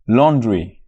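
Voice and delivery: usual speaking voice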